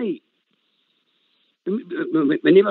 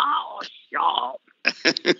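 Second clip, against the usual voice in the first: exasperated whisper; 5 semitones lower, 3 dB quieter than usual. Retold or imitated. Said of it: "I'm like".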